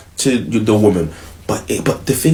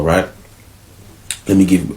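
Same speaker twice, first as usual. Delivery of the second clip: ordinary talk, nothing marked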